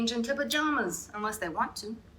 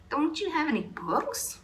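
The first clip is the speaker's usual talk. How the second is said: asking; 8 semitones higher than usual. A narrator's reading. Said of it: "I asked".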